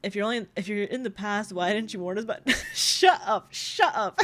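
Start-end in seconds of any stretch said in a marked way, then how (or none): none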